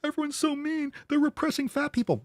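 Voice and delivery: high, mocking whine of a self-pitying victim; shrill-free mock victim whine, high; mocking whiny victim. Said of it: "they pretend they're the victim".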